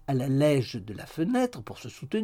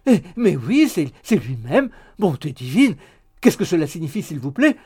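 loud theatrical amazement; astonished exclaiming; 3 semitones higher, 9 dB louder than usual. A narrator's reading. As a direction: exclaim loudly in mock astonishment. Maid exclaims on recognising him. (stupéfaction bruyante et théâtrale)

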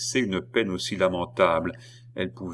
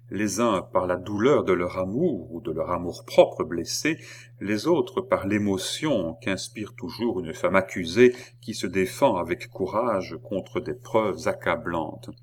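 ordinary delivery, nothing marked

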